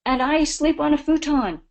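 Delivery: high, loud, excited retelling of the landowner; loud mock indignation from the woman woken from bed; excited French woman. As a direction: burst out like the startled French woman. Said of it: "And she said".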